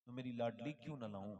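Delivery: soft, low fatherly question; hushed drop into affection; tender coaxing. Acted. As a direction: gently ask a beloved daughter. Father gently coaxes his dear daughter.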